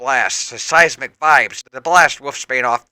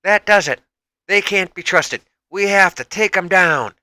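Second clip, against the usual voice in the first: higher, louder angry shout; loud, higher angry call to fight; screaming out; 7 semitones higher, 4 dB louder than usual. A narrator's reading. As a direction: yell it at the enemy. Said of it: "Rogue screams out".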